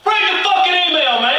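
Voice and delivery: loud demanding shout; mock furious director; angry yell. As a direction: yell the order.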